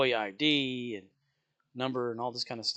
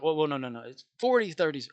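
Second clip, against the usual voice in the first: flustered correction; 4 semitones higher, 5 dB louder than usual. Retold or imitated. Cashier correcting confused customer.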